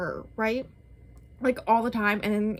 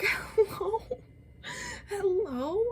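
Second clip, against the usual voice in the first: much higher, whiny mock cry; incredulous hello; 11 semitones higher, much slower than usual. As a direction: call out as if no one's thinking. Disbelief at teacher's decision.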